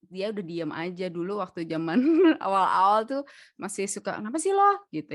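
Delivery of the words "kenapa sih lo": higher, louder irritated retort; retold snapping. Wife recalling her early snapping at him, irritated and high. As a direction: snap it in a raised pitch. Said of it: "dia juga kena semprot lah".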